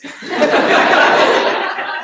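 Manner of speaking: high wheezing laugh; silent, breathy giggle; breathless laugh. Real, not acted. Laughing at her own story.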